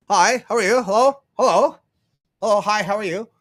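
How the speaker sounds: friendly greetings. Retold or imitated.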